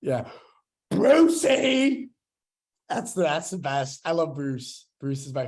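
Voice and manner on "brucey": loud, high character call. Acted. Fan calling the shark's name from Finding Nemo, hammed-up shout. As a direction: belt out the name, stretching the end.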